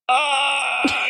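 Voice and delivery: high hammed-up cry of collapse; mock falling groan. Fan acting out a character keeling over, with a mock high wail. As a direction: let out a silly high wail as if fainting.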